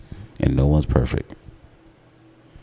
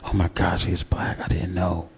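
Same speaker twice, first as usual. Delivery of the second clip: high, hushed whisper of surprise; mock shocked whisper; 4 semitones higher, 5 dB quieter than usual. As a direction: gasp it under your breath. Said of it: "it's like".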